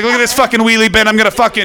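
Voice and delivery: high, quick sweary rant; Malcolm Tucker. Acted. Sweary Doctor facing a Dalek, as Malcolm Tucker. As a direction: rattle it off as a foul-mouthed Doctor. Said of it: "he was like".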